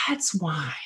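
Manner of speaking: whispered, as if choked up; retold tearful realization. Daughter reacting to parent's sobriety, imitated tearful whisper. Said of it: "she started crying".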